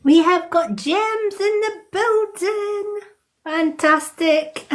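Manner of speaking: high, drawn-out singsong cheer; slow, high-pitched hurrah; excited announcement. Real, not acted. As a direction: announce a friend's arrival with glee, stretching it out high. Cheering a friend's arrival to the stream.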